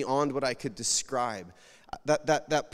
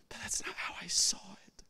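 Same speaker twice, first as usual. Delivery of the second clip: hushed aside; 4 semitones higher, 7 dB quieter than usual. Real, not acted. Emotional testimony, hushed.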